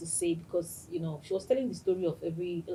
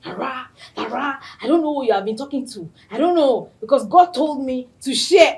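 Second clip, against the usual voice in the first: higher, louder, fast insistent imitation; imitating Stephanie; 5 semitones higher, 9 dB louder than usual. Host mimicking her friend insisting God told her, louder and higher. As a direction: play her friend protesting eagerly. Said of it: "Stephanie came. I said".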